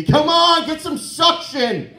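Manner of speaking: high, loud exasperated yell; screaming. Retold or imitated. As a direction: scream impatiently at the TV. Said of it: "screaming at the T V".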